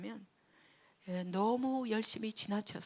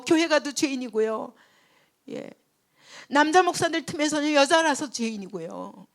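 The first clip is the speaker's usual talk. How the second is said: louder, higher pleading protest; preacher retelling her loud complaint in prayer; loud complaint to God; 8 semitones higher, 10 dB louder than usual. Retold or imitated. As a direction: cry out your frustration to God.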